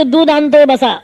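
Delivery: put-on a kid flaunting his errand to guests; loud cocky brag; showing-off boy. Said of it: "ठोकत जाऊन सांगते".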